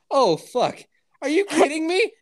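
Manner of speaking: imitating mom's alarmed disbelief; shocked outburst. Mom shocked about the swat team.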